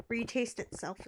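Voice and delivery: talking through hiccups; hiccuping. Real, not acted. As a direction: speak between hiccups, full stomach. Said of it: "I'm hiccuping".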